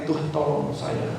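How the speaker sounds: soft, slow, humble request; hushed prayer. Acted. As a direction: pray quietly, bowed low.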